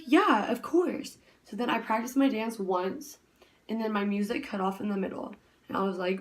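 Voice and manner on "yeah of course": high, slow, easygoing yeah of course; a lightly voiced friendly teacher; teacher's reply. Studio teacher agreeing to the dancer, high and slow.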